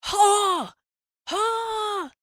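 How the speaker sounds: very high, long driving cries; drawn-out high-pitched yell; yelled command. A narrator's reading. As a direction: holler to steer a runaway team. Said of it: "Almanzo yelled".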